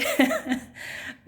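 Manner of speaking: very high squeal of a laugh. Real, not acted. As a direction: let a giggle squeak out high. Laughs at her own voice.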